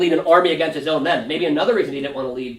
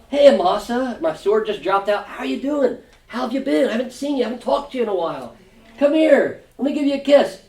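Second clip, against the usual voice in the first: high, sugary mock-friendly welcome; fake friendly greeting; 6 semitones higher than usual. Joab greeting Amasa before betraying him, mock-friendly. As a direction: lure him in with false warmth.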